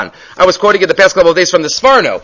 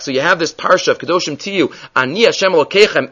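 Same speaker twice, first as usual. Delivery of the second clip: ordinary talk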